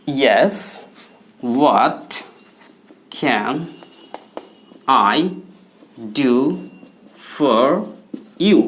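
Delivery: "Yes, how can I help you" with a long pause after each word. Shown as a demonstration. Teacher dictates the reply word by word, slowly. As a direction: dictate it one word at a time. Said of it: "অথবা বলবেন".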